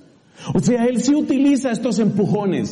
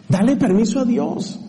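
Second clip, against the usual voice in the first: ordinary delivery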